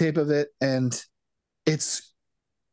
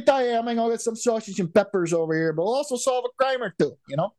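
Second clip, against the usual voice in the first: put-on middle-aged Chicago accent, higher; balding Chicago guy; 5 semitones higher, faster than usual. Podcaster pitching a cop-show character, Chicago guy voice. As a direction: play a fat, balding Chicago local. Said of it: "I need like a fifty-eight-year-old guy".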